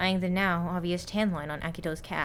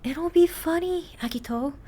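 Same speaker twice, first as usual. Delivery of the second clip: pleading; 10 semitones higher than usual. Acted. Kohane pleading with Akito to wear the costume. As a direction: sweetly beg him to go along. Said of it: "Kohane pleaded".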